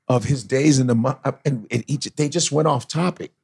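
ordinary delivery

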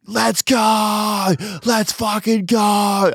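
high-pitched drawn-out roar; yelling. Retold or imitated. Rowdy footy fan roaring at a goal, yelling. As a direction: belt out a long, high fan yell. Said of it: "And I'm yelling".

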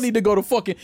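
usual speaking voice

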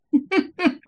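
high burst of laughter. Real, not acted. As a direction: laugh at his own joke. Speaker laughs at own story.

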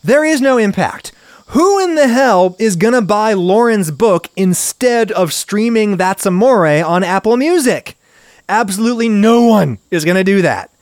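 exasperated and furious; angry rant. Real, not acted. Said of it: "anger cost me a sneeze".

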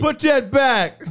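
high, loud slow order. Retold or imitated. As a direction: bark it like a suspicious clerk.

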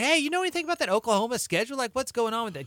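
high, sing-song fake-innocent probe; passive-aggressive. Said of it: "passive aggressively be like".

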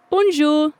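a bright, cheery greeting; cheery bonjour. A narrator's reading. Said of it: "said a cheery".

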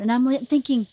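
ordinary talk

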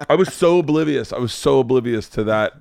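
normal talking voice

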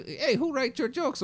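high, slow heckling captain; mock heckle. Captain heckles the comedian from the back of the showroom, mock taunt. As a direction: needle the comic from the back.

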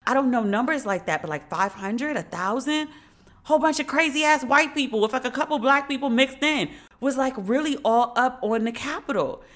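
ordinary delivery

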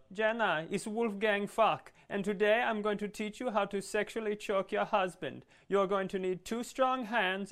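high, singsong mock Austrian accent; exaggerated high Austrian presenter voice; Wolfgang Fuck. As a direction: burst in as an Austrian TV chef giving a lesson. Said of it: "fling the door open and go".

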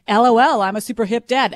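ordinary talk

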